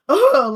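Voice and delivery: loud, exasperated "ugh"; irritated groan. Retold or imitated. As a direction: groan in annoyance, acted out. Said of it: "I'm irritated".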